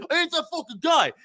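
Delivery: high-pitched imitation of an angry outburst; mock outburst. Mocking the man who got up to act tough, high mimicry. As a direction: squeal it as a mocking impersonation. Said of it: "getting up and then be like".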